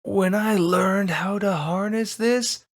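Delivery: villain revelling in his power; villain exaltation. Acted. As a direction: let the voice soar, half mad. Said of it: "villain speech".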